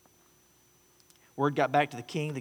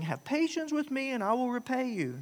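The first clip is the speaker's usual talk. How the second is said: higher pitched pleading plea; 8 semitones higher than usual. Acted. Fellow slave on the ground pleading with his creditor. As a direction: beg for mercy from your creditor. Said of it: "began to plead with him".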